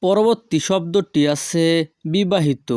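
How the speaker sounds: ordinary talk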